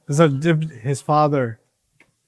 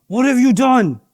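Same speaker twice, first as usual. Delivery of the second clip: angry yell; 8 semitones higher, 3 dB louder than usual. Shown as a demonstration. Showing the kids how to yell at Abdus Salam in anger. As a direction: demo of an angry crowd, building higher and louder. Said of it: "yell at him like you're angry".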